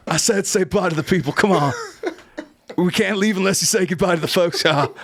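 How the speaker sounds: ordinary talk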